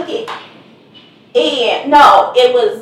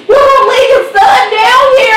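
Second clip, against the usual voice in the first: high, loud, crying plea; whiny plea; 10 semitones higher, 6 dB louder than usual. Retold or imitated. Son pleading with mom not to leave him, a high crying whine. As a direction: whine as if near tears. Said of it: "And he's like".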